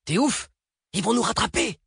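very high, incredulous outcry; panicked protest. Acted. Driver objects to stopping with pursuers close, panicked outcry.

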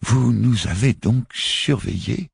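stammering. A narrator's reading. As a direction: stammer it out, thrown. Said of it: "balbutia le moufti". (le moufti balbutie, lent et plus aigu)

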